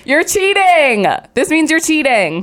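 high, slow mock accusation; teasing accusation. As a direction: call her out playfully. Friend accuses reader of cheating.

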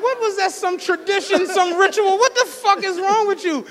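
high, loud outraged rant; mock outrage, high and loud; incredulous yelling. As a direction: yell it in disbelief at him. Ranting at the African kid.

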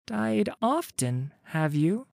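put-on a sceptical young woman asking; young Wreath. Wreath answers the dead man.

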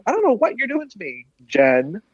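high-pitched, quick mock exasperation; frustrated. Imagining herself in Lisa's place confronting Jen, mildly frustrated. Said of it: "like, frustrated too, going".

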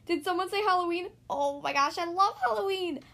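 high, loud, thrilled exclamation; excited outburst. A narrator's reading. Thistle suddenly appears, thrilled about Halloween, excited outburst. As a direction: burst in gushing with excitement.

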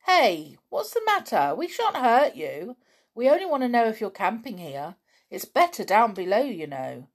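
loud, high, pushy call; calling after them. A narrator's reading. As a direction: chase them with your voice. Men chasing children, calling.

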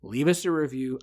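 ordinary talk, nothing marked

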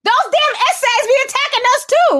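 a hammed-up whataboutism voice, fast and high; mocking whiny heckler. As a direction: lampoon the person derailing the talk. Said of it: "here comes Mex".

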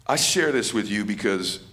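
usual speaking voice

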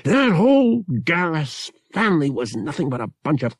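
snorting scorn. A narrator's reading. Pavak to Bria about Garrus family, scornful. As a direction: scoff at the whole family. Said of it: "He snorted".

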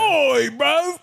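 high, squawky Cockney echo; mock British accent. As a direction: push the British accent into a squeal. Echoing the accent joke, pushed higher.

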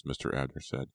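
ordinary delivery

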